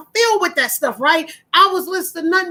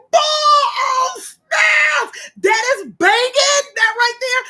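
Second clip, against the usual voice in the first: a host thrilled by an artist's new track; hyped; 10 semitones higher, much slower than usual. Retold or imitated. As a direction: rave about a track you love. Hearing a reworked track excitedly.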